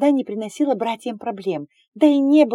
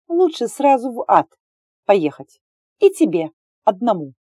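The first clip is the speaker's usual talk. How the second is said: angry retort; 3 semitones higher, 5 dB louder than usual. Acted. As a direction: spit the words back at him. Heroine refuses him sharply.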